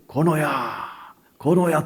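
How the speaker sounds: long-drawn calling of a name; stretched, echo-like summons; voice of God. Retold or imitated. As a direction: imitate God calling out slowly. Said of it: "내 이름을 부르는 거예요".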